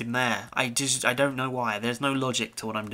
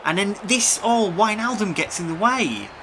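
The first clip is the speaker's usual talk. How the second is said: rising, louder play-by-play thrill; excited commentary; 6 semitones higher, 6 dB louder than usual. Real, not acted. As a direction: call the run as it builds. Gamer excitedly narrating attack.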